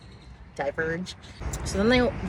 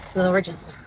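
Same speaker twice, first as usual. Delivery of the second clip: ordinary talk, nothing marked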